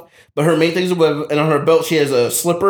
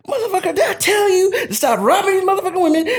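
high, mock-furious mama voice; faster than usual. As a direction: cuss him out as a shrill angry mama. Angry mama voiced in story.

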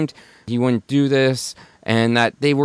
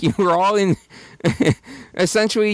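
laughing; 7 semitones higher, faster than usual. Real, not acted. Laughing at the song lyric.

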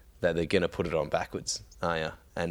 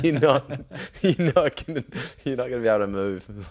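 laughing warning; 5 semitones higher, 3 dB louder than usual. Retold or imitated. Laughing while warning Kev.

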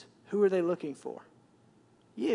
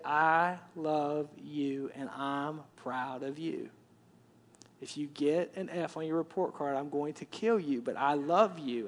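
slow, much softer and lower; tender father; 6 semitones lower, 6 dB quieter than usual. Retold or imitated. Father telling his daughter he loves her, slow and soft. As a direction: tell your daughter gently, slowly. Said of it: "and I say".

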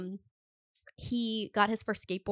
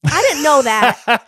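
surprised; 4 semitones higher, 17 dB louder, slower than usual. Real, not acted. Mock surprise at new fact.

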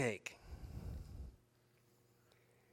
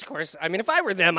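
usual speaking voice

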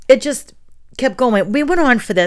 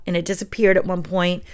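ordinary talk

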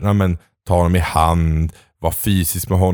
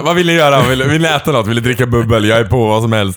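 higher and louder, gushing with fake eagerness; mock eager; 3 semitones higher, 4 dB louder than usual. Pretending to beg friends for plans, loud eager gush. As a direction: overeager party host offering bubbly.

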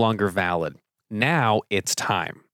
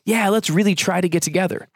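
breezy, empty promise with a lift; noncommittal promise; 8 semitones higher than usual. Shown as a demonstration. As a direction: say it like you'll never follow through. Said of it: "the one you're most familiar with".

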